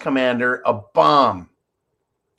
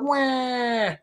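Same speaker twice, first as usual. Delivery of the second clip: fake crying; 12 semitones higher, 5 dB quieter than usual. Mock crybaby wail.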